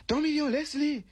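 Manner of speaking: high cry to let him go. Acted. A bystander cries out to stop Gros Million. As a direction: yell out to leave him alone. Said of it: "il crie".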